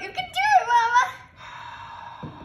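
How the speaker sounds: high, loud cheering; root for her at full voice. Real, not acted. Child cheers mom on.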